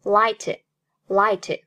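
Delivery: light with the final t held, not released; slow light, swallowing the t; unreleased t. Shown as a demonstration. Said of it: "light爆破音，t失去爆破". (light的t失去爆破)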